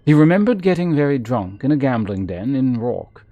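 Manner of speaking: lively read-aloud narrator voice; storybook reading, a touch dramatic; story narration. Acted. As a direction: read the book out like telling a tale. Reads game book aloud.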